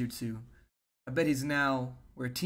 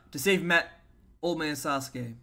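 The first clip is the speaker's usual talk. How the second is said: ordinary delivery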